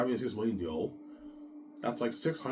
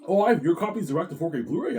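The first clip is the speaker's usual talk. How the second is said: higher, louder amazed question; excited Peter; 8 semitones higher, 8 dB louder than usual. Retold or imitated. Peter amazed at the Blu-ray release, higher and louder. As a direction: gush in a pitched-up voice. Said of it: "He's like".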